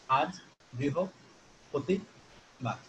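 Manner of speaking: "আজ বৃহস্পতিবার" broken into syllables, long pauses between; scanning speech. Shown as a demonstration. Lecturer demonstrating a cerebellar patient's speech, slow scanning syllables in "আজ বৃহস্পতিবার". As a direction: say "আজ বৃহস্পতিবার" very slowly, one chunk at a time. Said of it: "তাহলে সে এভাবে বলবে যে".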